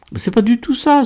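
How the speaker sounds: high-pitched protest; defensive. A narrator's reading. Kader denies to Miguel that he is in love, defensive. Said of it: "se défendit Kader".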